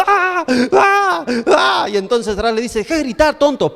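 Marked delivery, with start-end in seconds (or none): 0.1-1.9 high, repeated mock screams; hammed-up panicked wails, very high; frightened screaming. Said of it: "y seguía gritando". 2.8-3.7 higher-pitched, impatient telling-off; mock scolding. Acted scolding of screaming friend.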